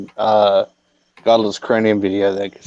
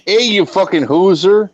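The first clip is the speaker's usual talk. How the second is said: mock Canadian hoser insult, slightly higher; Canadian hoser accent; 6 semitones higher than usual. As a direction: tease with a hoser drawl. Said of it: "Bob and Doug McKenzie".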